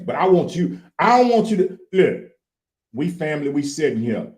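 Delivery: put-on high, excitable country boy; country boy character. Guest invites host to talk with old country boy, in character.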